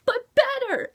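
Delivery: high, loud squeal of delight; gushing squeal. Real, not acted. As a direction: squeal it like a fan. Gushing over Cinder's speech.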